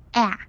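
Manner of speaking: 啊 as English short a, not ah; correct vowel. Shown as a demonstration. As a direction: say 啊 like the a in cat. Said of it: "那种啊的音". (把“啊”读成 cat 里的元音)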